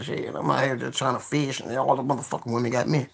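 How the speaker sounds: ordinary delivery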